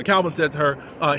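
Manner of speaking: normal talking voice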